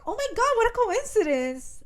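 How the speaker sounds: high, bright-pitched fake surprise; fake surprised girlfriend. Glendon pretending to bump into Killian, high fake surprise. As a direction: squeal it like a staged coincidence. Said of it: "she's like".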